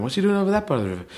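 hammed-up baffled onlooker; mock bystanders. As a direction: gawk at him, quickly. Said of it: "people going".

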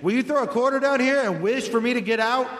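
high, plaintive call for help; fallen-in-a-well voice. Pretend. Trapped at the bottom pleading for rope, high calling voice. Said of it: "I've fallen in a well voice".

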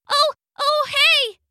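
high startled bug cry; startled gnat. Acted. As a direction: yelp as you get stuck. Gnat stuck in web.

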